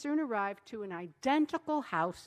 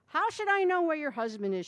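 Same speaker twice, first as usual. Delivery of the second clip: Schlemiel's retort; 8 semitones higher, 8 dB louder than usual. A narrator's reading.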